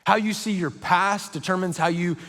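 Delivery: usual speaking voice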